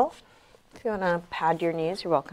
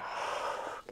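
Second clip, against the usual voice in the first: short, voiceless sigh; quick quiet breath out on the reach; soft exhale; 11 dB quieter than usual. Real, not acted. Breathing out while reaching back.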